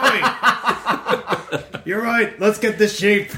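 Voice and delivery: ogre voice. Acted. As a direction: agree like a gleeful oaf.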